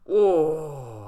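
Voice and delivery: loud, drawn-out "oh" of alarm; real shocked yelp, falling in pitch; startled cry. Real, not acted. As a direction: cry out at a near miss. Startled by near-fatal risky jump.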